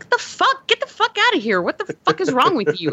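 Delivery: high, indignant mock outburst; replaying the moment she lost it; a high-pitched mock rant at a shocking story; retold angry outburst. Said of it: "I was so angry".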